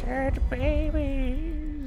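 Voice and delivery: creepy drawn-out. Acted.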